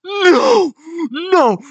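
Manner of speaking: high, loud screams of disbelief; shocked screaming. Real, not acted. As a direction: scream in refusal at a shock reveal. Reacting in shock to the reveal.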